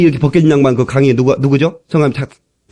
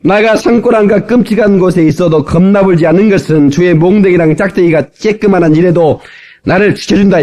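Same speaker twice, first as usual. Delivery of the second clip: raised-pitch Jeolla dialect recital; 전라도 사투리 낭송; 4 semitones higher than usual. Shown as a demonstration. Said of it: "전라도 버전을 제가 따왔습니다". (목청 높인 전라도 사투리 낭송)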